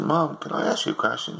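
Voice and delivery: put-on high, eager kid's voice; little boy. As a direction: play the curious little boy. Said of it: "a little boy come in".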